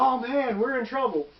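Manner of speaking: high-pitched dismay; mock alarm. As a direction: imitate players realising they are in danger. Retold players realise the danger.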